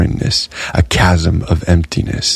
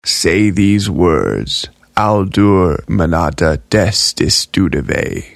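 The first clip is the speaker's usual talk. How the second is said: slow, measured spell words; a jar teaching a spell, slowly and deliberately; slow incantation; slower than usual. Acted. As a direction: intone the magic words slowly. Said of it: "the sigillum commanded".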